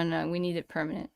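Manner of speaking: usual speaking voice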